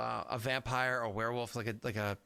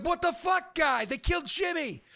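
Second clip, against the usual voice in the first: hammed-up, high, loud Boston outrage; obnoxious Southie; 7 dB louder than usual. Game master demos Bostonian Cthulhu campaign, mock-outraged Southie shout. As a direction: yell it as an outraged Bostonian, pitched way up. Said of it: "straight up obnoxious Southie".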